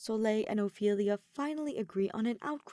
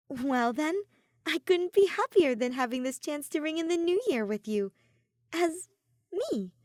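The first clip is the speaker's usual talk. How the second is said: much higher, louder, glad voice; happy Ophelia; 8 semitones higher, 5 dB louder than usual. A narrator's reading. Ophelia ringing in the new year with Soleil, high and glad. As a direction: beam with joy.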